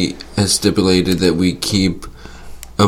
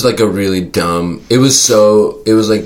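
normal talking voice

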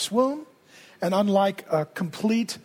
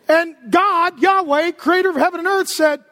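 usual speaking voice

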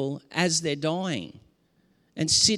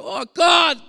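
a loud, high cry to God, then slower; lamenting cry; 7 semitones higher, 4 dB louder, slower than usual. Shown as a demonstration. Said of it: "we cry out and we go".

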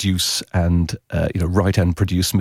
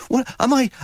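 incredulous. Retold or imitated. Incredulous reaction to bad promo.

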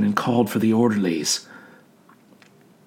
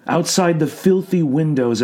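ordinary delivery

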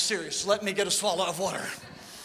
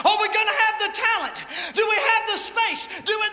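high, loud, frantic questions; anxious pleading church; 10 semitones higher, 3 dB louder than usual. Acted. Church pestering God about talent and space, high and loud. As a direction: pile on the worries, pitched up.

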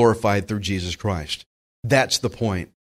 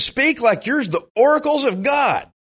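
much higher, ringing proclamation; preaching shout; 11 semitones higher than usual. Acted. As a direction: call it out like a preacher on fire. Preacher voicing Peter's charge.

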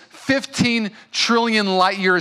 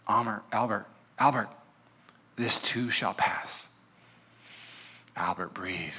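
put-on calm a frantic child, tenderly; gentle father; 6 semitones lower, 9 dB quieter than usual. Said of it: "never seen a father so gentle".

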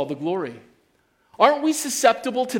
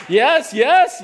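higher and louder, thrilled; excited yes; 6 semitones higher, 6 dB louder than usual. Real, not acted. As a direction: cheer it out like a fan. Cheering own story, excited.

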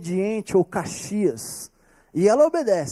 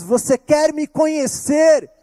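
raised plea; 8 semitones higher, 5 dB louder than usual. Acted. Jesus asks, raised.

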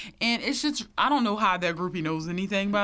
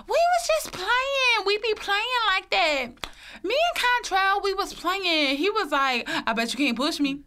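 mimicked girlfriend; 9 semitones higher than usual. Girlfriend explaining online that they were just playing, high mocking imitation. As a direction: imitate her excuse in a raised, put-on voice. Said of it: "his girlfriend goes on, you know, air, goes online. It was like".

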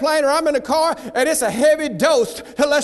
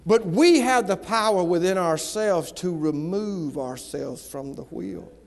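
the sad truth said low and slow, softer; low, soft and grave; 6 semitones lower, 5 dB quieter, slower than usual. Acted. Sad point, voice drops.

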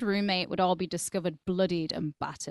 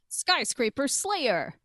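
headline read; 5 semitones higher, slower than usual. Retold or imitated. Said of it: "read the headlines".

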